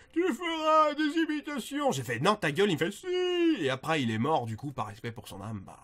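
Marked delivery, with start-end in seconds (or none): 0.1-1.9 put-on old man. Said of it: "il y a un vieux qui m'a dit". 2.2-2.7 higher, louder mock refusal; acted loud retort. Retelling his reply to the cave hermit, loud retort. 2.9-3.1 put-on old man. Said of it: "il y a un vieux qui m'a dit".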